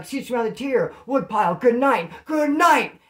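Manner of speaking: high, loud good-night call, building; calling down the tier. Acted. Inmate to the tier at lights out, calling out. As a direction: sing out so the whole tier hears.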